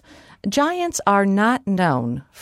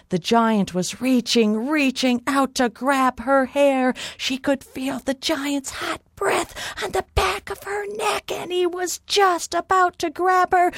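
mounting suspense; 8 semitones higher than usual. A narrator's reading. Giant about to grab fleeing Molly, high mounting suspense. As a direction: pitch climbing very high with tension.

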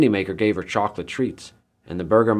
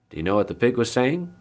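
ordinary delivery

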